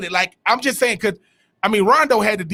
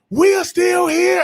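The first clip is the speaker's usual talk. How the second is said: much higher, slow, loud declaration; Morpheus shouting; 10 semitones higher, 3 dB louder, slower than usual. Retold or imitated. Retelling Morpheus rallying the cave crowd, slow defiant shout. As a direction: proclaim it like a defiant leader. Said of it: "He goes".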